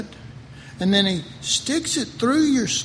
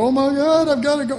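higher and louder, a panicked little cry; mock panic; 7 semitones higher, 5 dB louder, faster than usual. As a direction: play the frightened believer rushing off. Mock panic of frightened believer.

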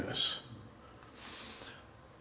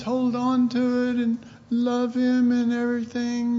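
higher, gushing crowd voice; a mock-excited crowd of believers, higher pitch; eager followers; 6 semitones higher than usual. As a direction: play the giddy disciples remembering. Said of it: "people were going".

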